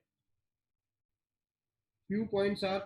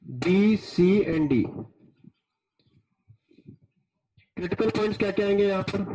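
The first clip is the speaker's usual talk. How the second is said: too close to mic; 10 dB louder than usual. Real, not acted. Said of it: "sir, थोड़ा mic दूर रखो".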